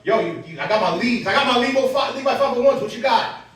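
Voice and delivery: loud, high schoolyard challenge; boastful schoolkids. Retold or imitated. Schoolmates confront Marv about his jeans, mock loud challenge. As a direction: imitate kids showing off their gear. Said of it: "So they were like".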